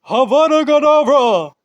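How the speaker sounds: high, loud magic incantation; shouted spell. Acted.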